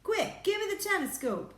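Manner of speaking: high, loud astronaut's shout; urgent yell. A narrator's reading. Astronaut demanding the telescope, urgent yell. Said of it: "yelled Shuckworth".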